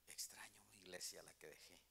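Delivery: soft whispered longing; retelling his homesick thought in a whisper; sad whisper. Retold or imitated. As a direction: whisper it like a homesick man in the back pew. Recalling his sad thoughts in church.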